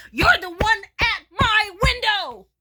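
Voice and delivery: high, loud, stretched-out accusation; indignant shout. Retold or imitated. To the peeping Tom at the window, indignant high shout. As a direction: yell it slowly, word by word.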